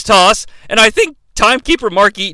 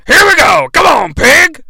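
high, loud excited yell; Vince McMahon impression; 9 semitones higher, 5 dB louder than usual. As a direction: holler at the pig like Vince. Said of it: "Vince oinks twice".

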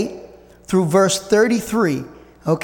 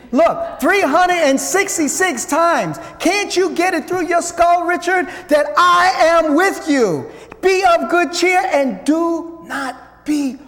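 God insisting; 6 semitones higher than usual. Acted. God pleading with Richard, high and insistent. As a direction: drive it home like God pleading.

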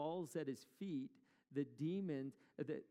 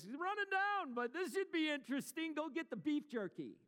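hammed-up pig farmer, pitched way up and louder; a much higher, louder farmer egging his buddy on to watch; 12 semitones higher, 4 dB louder than usual. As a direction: call out like an excited farmer up on the hill. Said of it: "imagine those pig farmers going".

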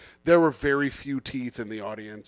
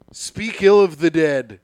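slow and louder, like a grand title; mock solemn announcement; 7 dB louder, slower than usual. Shown as a demonstration. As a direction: announce it as a solemn ceremony, slowly. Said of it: "now we're to it".

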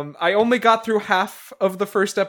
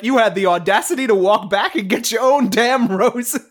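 raised, louder voice of outrage; mock indignant rebuke; 5 semitones higher, 5 dB louder than usual. Retelling her scolding Richard over the roses, mock indignant. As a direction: act out her telling him off.

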